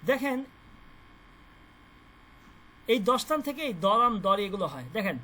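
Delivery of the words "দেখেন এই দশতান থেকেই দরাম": usual speaking voice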